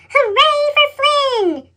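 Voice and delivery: high, slow cheer. A narrator's reading. Thomas cheers Flynn putting out the fire, high cheer. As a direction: cheer the hero on. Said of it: "Thomas peeped".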